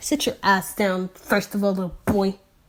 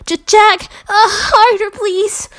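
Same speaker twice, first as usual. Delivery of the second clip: breathless plea; 13 semitones higher, 8 dB louder than usual. Acted. Pleading to Jack.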